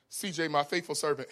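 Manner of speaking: usual speaking voice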